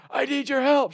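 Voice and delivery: high, loud mock plea; hammed-up desperate cry; crazy stranger. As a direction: imitate a wild person begging. Imagined crazy person pleading.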